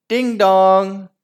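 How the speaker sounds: loud high ding dong; doorbell chime. Acted. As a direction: ring out like a doorbell. Doorbell rings.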